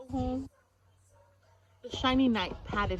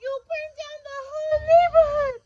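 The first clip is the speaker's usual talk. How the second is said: high, loud shriek of alarm; a mother screaming over the burning neighborhood; cried out; 10 dB louder than usual. Acted. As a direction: shriek it, much higher and louder. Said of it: "cried the mother".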